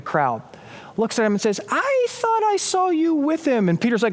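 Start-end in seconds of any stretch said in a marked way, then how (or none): 1.7-3.7 high, drawn-out accusing voice; suspicious bystander. Retold or imitated. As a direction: stretch it out high, like a nosy onlooker. Acted bystander challenging Peter.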